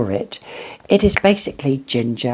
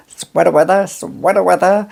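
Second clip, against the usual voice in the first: higher, louder mock American accent; badly faked US accent, pitched up; 5 semitones higher, 4 dB louder than usual. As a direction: have a playful go at an American accent. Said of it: "I can't do the accent".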